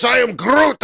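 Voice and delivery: high, loud catchphrase shout; loud Groot. Acted. Trader returning to his catchphrase, loud Groot. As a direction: belt it out as a hero's declaration.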